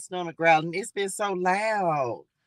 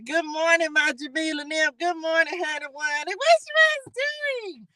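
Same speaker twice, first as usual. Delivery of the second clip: high sweet hello to a viewer; squealed greeting; 7 semitones higher than usual. Real, not acted. Host greets a viewer by name in a high squeal. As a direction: greet a fan brightly.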